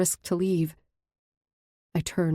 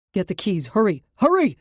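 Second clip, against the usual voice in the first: loud, urgent yell to others; a man barking hurried orders; 3 semitones higher, 5 dB louder than usual. A narrator's reading. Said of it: "the tallest of the men yells".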